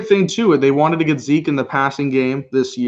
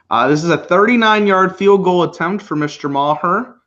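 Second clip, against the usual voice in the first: play-by-play call; 5 semitones higher, 3 dB louder than usual. Acted.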